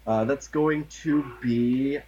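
ordinary talk

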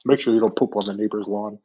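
normal talking voice

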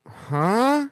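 mock baffled huh; confused huh. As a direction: sound totally lost. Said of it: "I would have been like".

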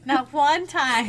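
slow, loud laughing repeat. Real, not acted. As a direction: laugh through the words. Laughing at the pun.

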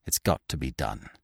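low, hushed, reluctant resolve; faltering. A narrator's reading. Frightened widow insists on the killing, faltering softly. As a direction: force it out weakly. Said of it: "she stammered".

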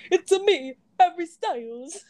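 put-on comic Italian accent, stretching the name. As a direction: do a mock Italian introduction. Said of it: "In an Italian accent".

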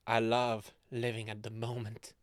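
ordinary delivery

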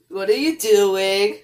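slow, low-pitched puzzled question; drawn-out question. Pretend. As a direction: stretch the words out low and slowly. First of three escalating takes.

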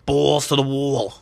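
slow, drawn-out mimicry of mom's catchphrase; imitating mom. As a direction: stretch it out like a tough New York mom. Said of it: "Or she would go".

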